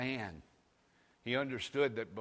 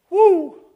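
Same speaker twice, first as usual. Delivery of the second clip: loud, high preacher's whoop; full-throated church woo; excited whoop; 19 dB louder than usual. Real, not acted. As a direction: let out a joyful holler.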